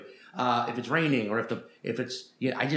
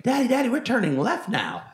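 higher, louder mock little kid; eager kid; 10 semitones higher, 4 dB louder than usual. Kid on a plane calls out the turn to his father, high, eager and a little louder. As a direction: imitate the kid chirping to his dad. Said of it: "he was just like".

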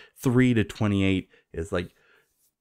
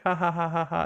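high-pitched fake "ha ha ha"; mock laughter; 9 semitones higher than usual. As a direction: imitate a forced group laugh up high. Imitates characters laughing at pun.

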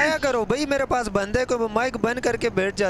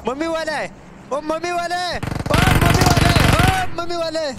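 excited callout; 6 semitones higher, 3 dB louder than usual. Real, not acted. Repeated excited callout of enemy.

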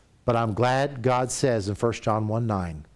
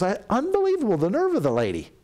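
exasperated disbelief; 9 semitones higher, faster than usual. Retold or imitated. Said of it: "She goes".

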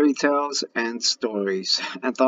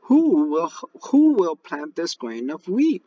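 higher, louder hen voice calling for help; hen asking; 7 semitones higher, 4 dB louder, slower than usual. Acted. Little red hen asking the lazy animals, raised hen voice. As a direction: ask it as the eager little hen. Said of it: "she asked".

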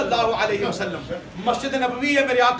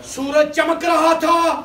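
high, drawn-out oratory; full-force preaching; 4 semitones higher, 4 dB louder, slower than usual. Real, not acted. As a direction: build the miracle in slow, ringing phrases. (بلند آواز میں جوشیلا خطاب)

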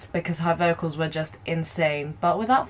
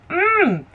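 high swooping "mm"; appreciative "mm"; 10 semitones higher, 4 dB louder than usual. Real, not acted. Reacting to their fierce looks, appreciative high hum. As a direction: hum it high, then let it drop.

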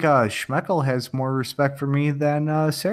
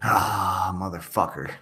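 low muttered curse; weak drill sergeant try; half-hearted military shout; 5 semitones lower, 5 dB quieter than usual. Shown as a demonstration. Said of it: "That's all you got?".

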